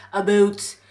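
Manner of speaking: "About" said as "aboot", Canadian vowel; Canadian accent. Shown as a demonstration. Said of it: "nobody really talks like".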